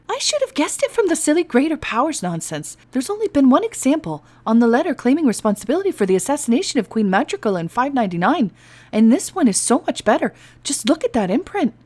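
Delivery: high, loud, rushing enthusiasm; excited. Acted. An elf gushing to the guard about the seal. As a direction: gush over the imprint. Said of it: "Maisie peered excitedly".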